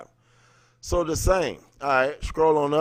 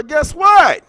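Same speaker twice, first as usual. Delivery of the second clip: loud, high, triumphant; excited shout; 12 dB louder than usual. Real, not acted. To his live viewers about census records, excited shout. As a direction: shout it out to the viewers like a big reveal.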